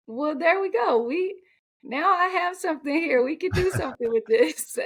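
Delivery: hopeful relief. Retold or imitated. Relieved reaction to doctor's news.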